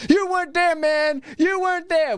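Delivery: high, mock-mystical far-off voice, well above his own; imitating Bongino's cryptic post. Host mocking Bongino's cryptic post, high spooky voice. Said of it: "Gnostic cryptic post where he's like".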